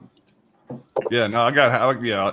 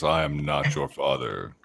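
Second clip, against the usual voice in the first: much lower, very slow, softer villain line; Vader parody; 10 semitones lower, 8 dB quieter, much slower than usual. Pretend. Joking about Star Wars, slow low mock Vader. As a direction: drag it out like a movie villain. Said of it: "Maury Povich version of Star Wars".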